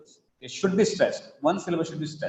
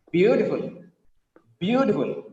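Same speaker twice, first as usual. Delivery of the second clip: "Beautiful" with a stretched BEAU that slides down; BEAU-tiful, modelled for the class; expressive first-syllable stress. Shown as a demonstration. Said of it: "Like this".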